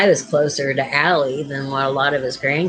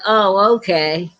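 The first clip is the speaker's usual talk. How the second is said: high, slow, drawn-out oh okay; grandma accepting the excuse, high and slow; slow grandma acceptance; 6 semitones higher, 3 dB louder, slower than usual. Retold or imitated. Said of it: "She said".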